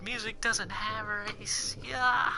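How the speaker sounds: high, drawn-out exasperated retort; mock exasperation. Retelling his annoyance at friends' music remarks, high mock exasperation. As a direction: stretch it out high, as if fed up. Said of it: "it bugged me a little bit".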